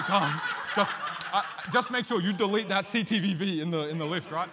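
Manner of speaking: loud, high, laughing mock order; hammed-up command through laughter. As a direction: bark it out while cracking up. Retold order to wife in lift.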